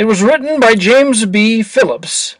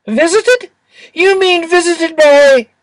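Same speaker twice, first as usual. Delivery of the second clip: high, puzzled little angel voice; surprised cherub; 8 semitones higher than usual. A narrator's reading. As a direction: ask it wide-eyed and high.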